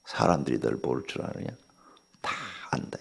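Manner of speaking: hushed and knowing; whispered warning. Retold or imitated. Pastor silently judging the proud councilman, whispered. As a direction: breathe it like a secret judgment.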